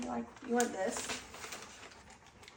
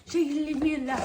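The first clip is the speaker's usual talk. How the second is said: higher and louder, pushing the question; louder repeat; 5 semitones higher, 7 dB louder, faster than usual. Acted. Owner asking the dog again whether Dad should leave her alone, louder.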